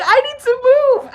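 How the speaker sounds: high, loud retold alarm; mock-panicked high-pitched outburst; urgent panic. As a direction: blurt it like you must escape now. Retold urgent panic beside the fight.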